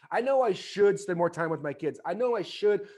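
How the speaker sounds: usual speaking voice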